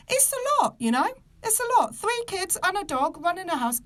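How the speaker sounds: overwhelmed exclamation; exasperated, high-pitched. Real, not acted. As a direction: voice jumps high in disbelief.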